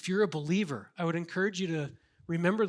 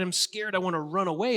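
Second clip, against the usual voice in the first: ordinary talk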